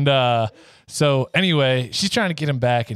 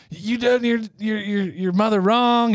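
put-on hot-tempered country girl; 10 semitones higher than usual. Country girl accuses Tyrone of wronging mother, high and angry. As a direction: lay into him with high, stretched outrage. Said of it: "hot. She's a good old country girl".

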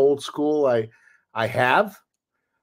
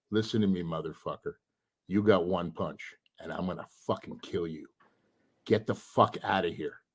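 low, much softer, deadly quiet threat; low menacing threat; 6 semitones lower, 8 dB quieter than usual. Retold or imitated. Bouncer quietly threatening the boyfriend, low and menacing. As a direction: menace him under your breath.

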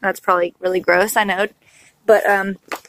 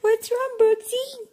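high soothing; 13 semitones higher, 4 dB quieter, slower than usual. Real, not acted. Baby crying, mother soothes.

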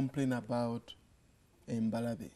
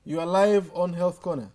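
loud and clear for a bad line; to someone hard of hearing; 4 semitones higher, 8 dB louder than usual. Real, not acted. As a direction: project every word to the caller. Caller hard to hear.

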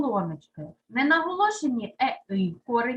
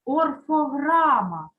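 орфограма drawn out slowly, each syllable set apart; slow term introduction; 5 dB louder, slower than usual. Shown as a demonstration. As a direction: lay out орфограма slowly like a new word on the board. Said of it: "хочу ще пояснити таке нове поняття".

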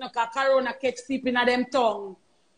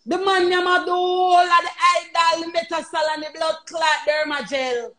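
furious rant; 5 semitones higher than usual. Real, not acted. Ranting shout about stolen creams.

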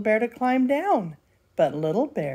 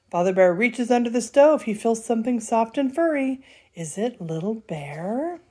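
ordinary delivery, nothing marked

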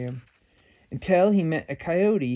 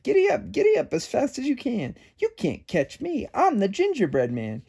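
much higher teasing singsong; taunting cookie; 8 semitones higher than usual. A narrator's reading. The cookie taunts the javelinas, high singsong. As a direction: tease them from far ahead. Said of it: "just laughed and said".

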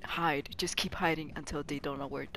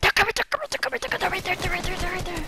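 high, loud panicked warning; frantic high shout to a teammate; 12 semitones higher, 10 dB louder, much faster than usual. Real, not acted. Warning teammate of approaching enemies.

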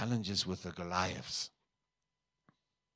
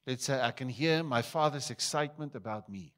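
louder, higher call for the church to echo; call to repeat; 5 semitones higher, 7 dB louder than usual. Real, not acted. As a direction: lead the crowd in a loud declaration. Calls congregation to repeat aloud.

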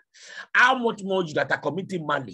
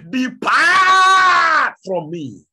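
booming, drawn-out, much higher and louder command; thunderous voice; 12 semitones higher, 13 dB louder, much slower than usual. Acted. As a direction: thunder it down from heaven. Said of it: "with a thunderous voice".

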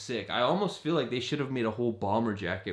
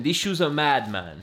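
put-on lively London accent, pitch dropping on the last word; 3 semitones higher than usual. Sneakerhead showing how they'd say it in London, London accent. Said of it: "I did a London accent".

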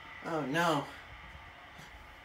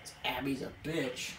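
ordinary delivery

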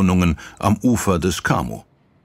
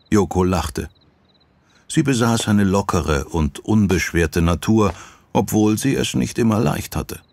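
ordinary delivery

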